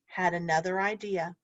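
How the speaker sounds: usual speaking voice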